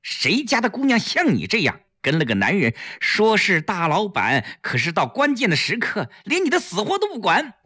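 high-pitched scolding; hastily cutting in. A narrator's reading. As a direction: cut a friend off before they speak. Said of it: "赶紧制止". (急忙高声制止、数落)

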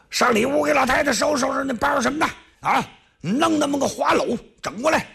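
high, fast bandit voice; barking orders at the villagers. Acted. 兵丁吩咐收拾. (高声快嘴的喽啰)

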